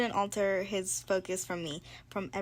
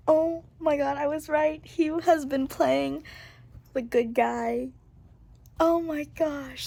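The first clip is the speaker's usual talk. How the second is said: higher and louder, bursting with excitement; thrilled; 7 semitones higher, 7 dB louder than usual. Real, not acted. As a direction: gush like your guess just came true. Reader thrilled guess came true.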